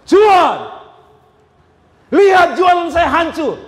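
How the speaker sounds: shouting up at God in anger; angry outcry. Acted. Seller shouting at God over ruined goods, angry shout. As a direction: cry out to God, furious. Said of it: "dia marah sama Tuhan".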